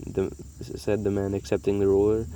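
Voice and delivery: ordinary delivery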